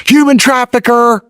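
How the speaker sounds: put-on high, slow sneer of the girl filming; mocking the American girl. Girl filming him in the bar, high mocking voice. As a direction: squeak it out like the accuser. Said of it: "she filmed me on TikTok and said".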